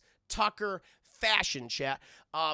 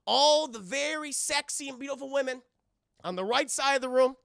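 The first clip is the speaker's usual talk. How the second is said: higher, louder cop announcement; Chris Tucker; 5 semitones higher, 8 dB louder than usual. Retold or imitated. Chris Tucker addressing the bar as LAPD, higher loud voice. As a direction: address the whole bar like a showman. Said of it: "And he's like".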